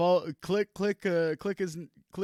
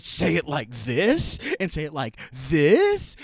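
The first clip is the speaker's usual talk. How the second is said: mock-insinuating stress on "this", pitched sharply upward; loaded inflection; 5 dB louder than usual. Shown as a demonstration. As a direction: punch and lift the word "this" both times. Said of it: "in a certain inflection".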